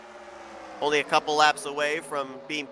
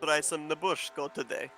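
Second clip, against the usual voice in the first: mock Russian accent in a jokey ad pitch; bad Russian accent; 6 dB quieter than usual. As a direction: pitch it like a cheesy Russian ad, with a half-hearted Russian accent. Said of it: "my horrible attempt at a Russian accent".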